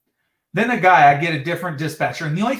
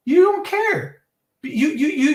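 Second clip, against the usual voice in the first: sudden much higher mocking squeak; mock him in a shrill high voice; 9 semitones higher than usual. Caller mocks the dispatcher who doesn't care, high mocking voice.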